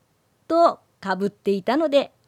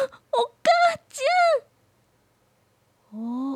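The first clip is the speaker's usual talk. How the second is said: put-on high tearful wail, lost child; crying girl; 4 dB louder than usual. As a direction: sob out the words in fear.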